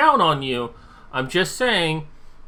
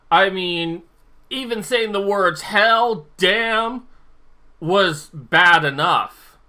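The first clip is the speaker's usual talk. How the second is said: ordinary talk, nothing marked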